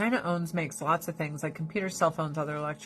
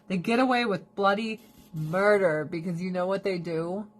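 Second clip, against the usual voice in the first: ordinary delivery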